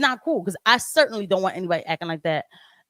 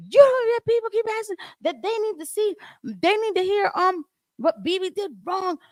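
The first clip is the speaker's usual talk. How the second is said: imitating her angry tirade, much higher; mock yelling; 10 semitones higher than usual. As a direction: parrot her lecturing rant in a raised pitch. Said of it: "this girl's yelling".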